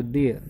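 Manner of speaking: "देयर" with no R at the end; silent final R. Shown as a demonstration. Teacher repeats the word after the rule, silent R. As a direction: let "देयर" end on its vowel. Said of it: "रै pronounce नहीं करते".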